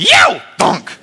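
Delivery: high yelped yow, dull thunk; mock yelp and thud; imitated yelp and thud. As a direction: sound effect of getting clotheslined. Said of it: "that was the literal sound".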